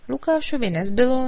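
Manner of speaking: ordinary delivery, nothing marked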